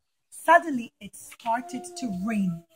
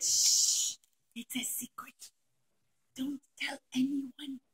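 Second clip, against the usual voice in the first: whisper; 6 dB quieter, slower than usual. Acted. Yehuda tells his secret.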